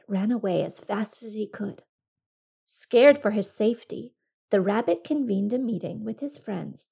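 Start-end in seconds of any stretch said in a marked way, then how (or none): none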